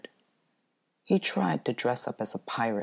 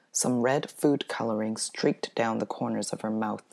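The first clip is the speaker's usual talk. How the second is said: ordinary delivery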